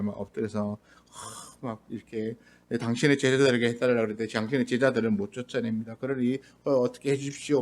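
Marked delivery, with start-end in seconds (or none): 2.8-7.6 a meek, mild request, quieter and quick; politely restrained plea. Retold or imitated. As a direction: plead softly and politely, no fuss. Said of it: "되게 점잖게 얘기하는 것처럼".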